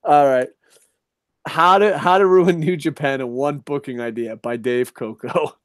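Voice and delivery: usual speaking voice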